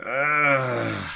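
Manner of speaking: long drawn-out "ugh"; exasperated groan. Retold or imitated. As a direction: groan at a nuisance. Groans at the inconvenience.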